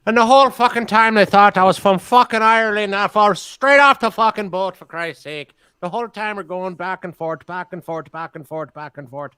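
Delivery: high, animated Irish brogue; Irish accent. Acted. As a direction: play a loud Irishman, easing off by the end. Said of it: "I wasn't from Ireland".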